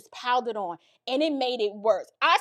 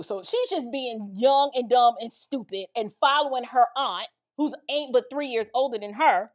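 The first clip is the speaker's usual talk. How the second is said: usual speaking voice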